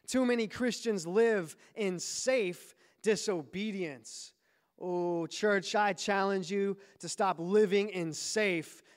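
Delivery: slow, emphatic sermon build with long-held key words; impassioned preaching. Real, not acted. Pastor challenging church to obey the command, impassioned preaching. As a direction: challenge the church with rising conviction.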